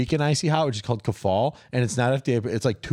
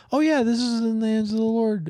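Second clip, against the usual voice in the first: much higher, sing-song fake-holy tone; mock pious voice; 13 semitones higher than usual. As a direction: put on a pious churchgoer voice to mock him. Mock pious believer quote.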